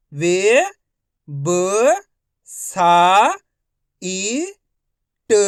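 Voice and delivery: each syllable called out loud and separate. Shown as a demonstration. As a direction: sound out every syllable slowly with a pause. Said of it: "एक एक अक्षर का उच्चारण करके".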